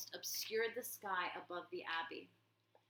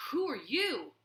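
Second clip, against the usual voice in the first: high, loud frightened challenge; a bully suddenly afraid; shaken voice; 5 semitones higher, 7 dB louder than usual. Acted. Warlord facing the nightmare visitor, high frightened cry. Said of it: "Clooney's voice shook".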